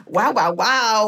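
slow, drawn-out admiring wow; gushing tailor. Retold or imitated. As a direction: stretch out each wow, impressed. Tailor gushing over weight loss.

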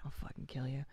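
joking threat, whispered; whispered, playful menace; whispered mock threat. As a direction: breathe it as a fake threat.

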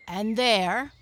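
loud, pointed declaration. A narrator's reading. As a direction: call it out for everyone. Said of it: "in a loud voice so that everyone should hear".

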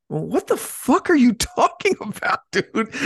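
can't believe it, laugh while saying it; laughing disbelief. Real, not acted. Incredulous retelling of coworker's paranoia.

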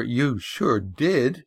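slow, emphatic contradiction; girl contradicting the boy, slow and firm; defiant girl. Acted. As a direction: cut him off firmly as the girl. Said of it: "said the girl".

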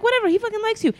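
high excited quote. Retold or imitated. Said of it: "I was like".

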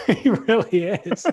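real laughter breaking through the words; laughing agreement. Real, not acted. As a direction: agree while cracking up, drawing it out.

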